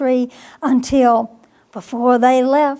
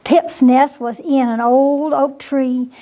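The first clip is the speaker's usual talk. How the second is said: ordinary delivery, nothing marked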